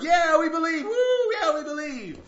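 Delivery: high, loud whooping cheer; cheering crowd. Acted. Crowd cheers on the tightrope walker, high excited shouting. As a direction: shout like a wildly eager crowd. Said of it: "the crowd's cheering".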